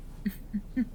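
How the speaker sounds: short, quiet amused laugh; soft chuckle. Acted. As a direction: chuckle under your breath. Amused by endless questions.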